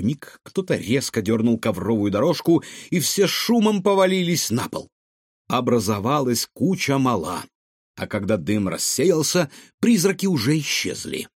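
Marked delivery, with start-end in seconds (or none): none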